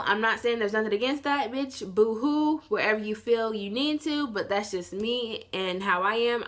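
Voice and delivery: ordinary delivery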